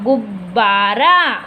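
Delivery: slow, low "गुब्-बा-रा"; sounding out. Shown as a demonstration. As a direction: dictate "गुब्बारा" in slow separate syllables. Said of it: "गु, फिर उसके बाद आधा ब".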